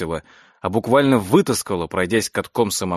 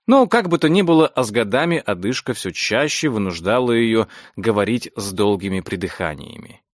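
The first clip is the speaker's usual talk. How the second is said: plain reading voice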